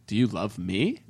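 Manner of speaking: low, soft acted plea; vulnerable question. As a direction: ask it softly and low, after hesitating. Voices man's vulnerable question to her.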